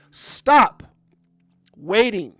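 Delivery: loud, high, punched motivational order; emphatic command. Real, not acted. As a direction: drive it home like a coach.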